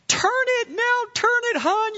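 whiny imitated wife begging her husband; pleading wife. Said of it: "as a wife".

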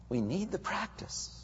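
ordinary talk

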